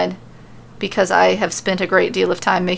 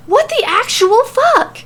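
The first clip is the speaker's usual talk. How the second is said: outraged shriek; 5 dB louder than usual. Acted. Sammy reacting in outrage.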